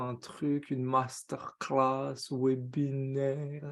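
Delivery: slow, low, droning parody; mock lecturing. Host mocking top-down masterclasses to his chat, droning. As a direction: drag it out softly like a dull webinar.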